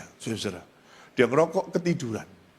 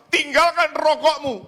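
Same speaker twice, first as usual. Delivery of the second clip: high, loud pulpit command; raised, ringing imitation of preaching; preacher's shout; 13 semitones higher, 4 dB louder than usual. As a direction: shout it like a fiery sermon order. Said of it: "teriak-teriak".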